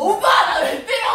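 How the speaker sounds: slow, loud, high outraged shout. Acted. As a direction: cry out as if just hit. Staged fight, cries out at brother.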